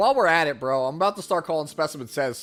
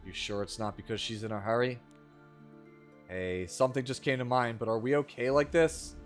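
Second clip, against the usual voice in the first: bad acting; 5 semitones lower, 6 dB quieter than usual. Reads game dialogue aloud.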